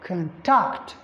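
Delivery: "Contact" with short con, stressed TACT; stress on second syllable. Shown as a demonstration. Teacher repeating the verb form, TACT stressed in "Contact". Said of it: "If it is a verb".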